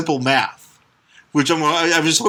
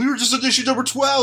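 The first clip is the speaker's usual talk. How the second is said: mock plea; 9 semitones higher, faster than usual. Mock Spider-Man pleading.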